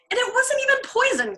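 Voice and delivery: high, heated outburst; heated cry. A narrator's reading. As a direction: cry it out hotly, flustered. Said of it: "cried heatedly".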